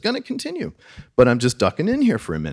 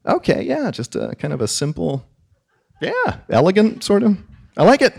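a hammed-up corporate pushover; mock corporate yes-man; 7 semitones higher than usual. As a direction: cave in brightly to the boss. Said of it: "what I heard myself say".

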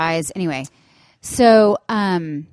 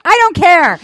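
high, loud dismissive snap; imitated angry mom; 11 semitones higher, 10 dB louder than usual. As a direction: fling it back coldly and loud. Mother voiced in story.

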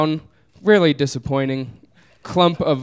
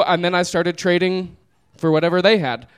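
usual speaking voice

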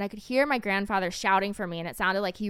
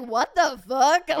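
mock shock; 4 semitones higher, 6 dB louder, much slower than usual. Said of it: "basically he was like".

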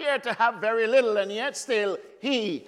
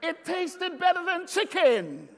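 coaxing boast; 8 semitones higher than usual. Real, not acted. Coaxing amens with a laugh.

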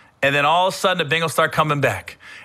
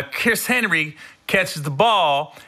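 usual speaking voice

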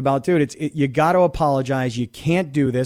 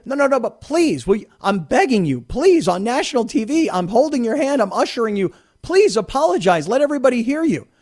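higher, pleading mock host voice; imitating Lemon begging; 6 semitones higher than usual. Host imitating Lemon begging the guest on air, pitched-up plea. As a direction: beg him to say sorry, voice pitched up. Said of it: "Lemon just keeps pounding on him".

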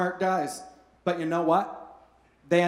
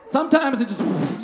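normal talking voice

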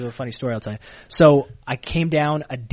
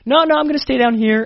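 high, loud, flustered mock protest; nervously blurted, pitched up in imitation; retold nervousness; 11 semitones higher, 9 dB louder than usual. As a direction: act out a nervous new guy insisting.

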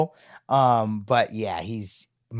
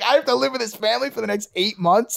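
put-on higher, horrified voice of the boarding kid; 10 semitones higher than usual. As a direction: play the stunned new houseguest, higher. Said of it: "like, horrified".

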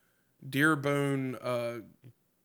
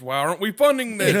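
loud, raised cartoon-dad outburst; higher, louder comic indignation; Peter from Family Guy; 8 semitones higher, 8 dB louder than usual. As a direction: act out the cartoon punchline with force. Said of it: "the Family Guy sketch".

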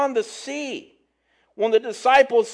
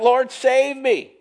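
mild cry for help; 4 semitones higher, slower than usual. A narrator's reading. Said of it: "he cried out".

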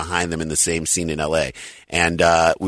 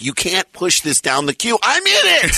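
retold outraged plea rising to a high cry; indignant protest; 10 semitones higher than usual. Pleading with the family to watch their show, indignant. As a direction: pitch leaping up high on the punchline. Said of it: "And I'm like".

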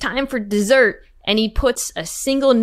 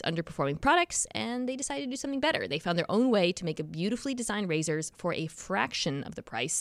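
casual host-read ad, softer in places; chatty sponsor spot, light jokes; 6 dB quieter than usual. Acted. Reads sponsor ad.

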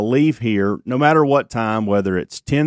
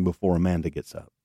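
lower, softer aside; hushed, near-whisper; 4 semitones lower, 7 dB quieter than usual. Real, not acted. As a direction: drop your voice so she won't wake. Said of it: "I'm, I'm whispering".

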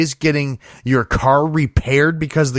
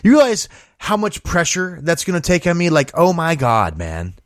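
ordinary talk

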